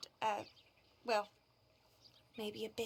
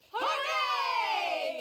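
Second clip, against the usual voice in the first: cheer; 8 semitones higher, 4 dB louder than usual. Acted. Cheering to rally the march.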